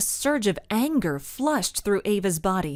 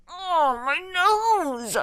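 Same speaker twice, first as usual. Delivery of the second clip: screamed; 10 semitones higher, much slower than usual. A narrator's reading. The Nose hit in the face, high pained cry. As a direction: yelp with pain. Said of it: "he screamed".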